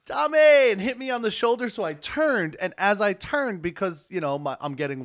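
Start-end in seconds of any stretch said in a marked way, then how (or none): 0.0-0.7 loud high call across a room; calling out a name. Retold or imitated. Father of the bride calling Tommy, loud high call. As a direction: shout the name to get his attention.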